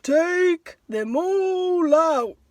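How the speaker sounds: slow, drawn-out sage voice; a very slow, measured wise old man. Acted. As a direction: speak slowly like a calm old sage. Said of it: "said the wise old man".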